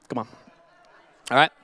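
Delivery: ordinary talk, nothing marked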